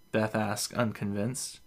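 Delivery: ordinary delivery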